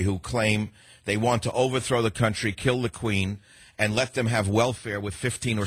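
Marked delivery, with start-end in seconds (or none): none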